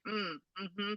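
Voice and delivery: a bored, snubbing hum; Siri with attitude. Retold or imitated. Siri snubbing her with a quiet, dismissive hum. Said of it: "she had an actual attitude".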